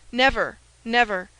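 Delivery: firm denial. Acted. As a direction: deny it beyond all doubt. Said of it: "with an accent that admitted no doubt".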